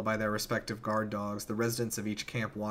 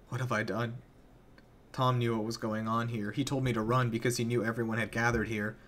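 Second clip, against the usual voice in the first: ordinary delivery, nothing marked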